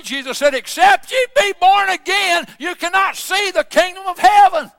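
pulpit preaching that climbs to a high, ringing scripture quote; impassioned preaching. Real, not acted. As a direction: build from firm argument to a high proclamation. Preaches, rising to a scripture cry.

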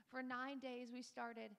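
normal talking voice

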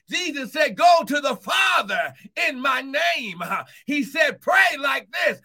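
loud, high, shouted preaching, building to a cry; full force preaching. Acted. Pastor shouting the gospel to his congregation, impassioned full force. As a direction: preach it out with everything you've got.

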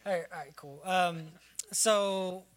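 ordinary delivery

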